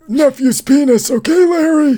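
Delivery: put-on high, strained dying old man; gravely ill. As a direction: croak it out like he's on his deathbed. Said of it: "Your impression makes it sound like he's on his deathbed".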